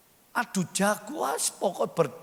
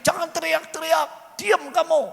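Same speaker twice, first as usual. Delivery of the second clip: raised, higher voice, mock scolding; acted telling-off; 7 semitones higher, 5 dB louder than usual. Crowd silencing a blind man crying out, acted loud scolding. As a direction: imitate a crowd hushing someone loudly.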